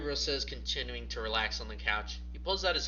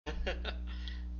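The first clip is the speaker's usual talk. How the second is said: quiet breathy chuckle at herself; a soft embarrassed laugh under the breath; 7 semitones higher, 3 dB quieter than usual. Real, not acted. Narrator loses composure mid-read.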